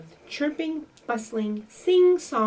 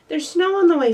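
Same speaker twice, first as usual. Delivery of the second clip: urgent and louder, higher, a little out of breath; storybook puppy panting out the news; 8 semitones higher, 9 dB louder, faster than usual. Acted. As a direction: hurry the warning out, mock breathless. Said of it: "panted Fletcher".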